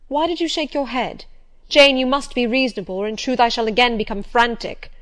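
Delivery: quivering agitation. A narrator's reading. Rochester warns a resisting Jane to be reasonable, agitated and loud. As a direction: press the point on the edge of frenzy. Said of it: "His voice and hand quivered".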